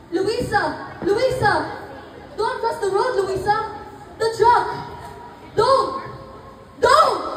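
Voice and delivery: high, rising panicked calls; calling out in panic. Acted. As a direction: shout a warning as the truck comes. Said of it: "calling out her name".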